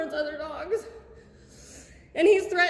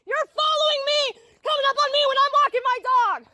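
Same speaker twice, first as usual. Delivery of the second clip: upset shouting, higher and louder; frightened angry shouting at the office; real screaming; 8 semitones higher, 5 dB louder than usual. Real, not acted. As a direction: yell it out, rattled and accusing. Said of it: "you cannot be screaming like that in here".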